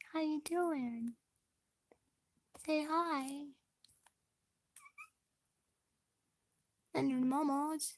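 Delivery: a light pet-talk voice; soft baby talk to pets. Acted. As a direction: coo gently at the cat.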